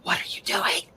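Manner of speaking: hushed, unvoiced whisper; puzzled whispered question. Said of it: "he's, like, whispering".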